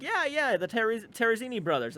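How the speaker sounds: imitating insistent friend. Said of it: "And she's like".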